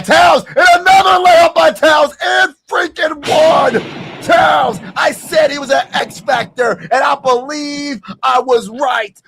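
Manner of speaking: high, loud, slowed victory shouting; triumphant yell. Real, not acted. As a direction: gloat at full volume. Celebrating Towns and-one.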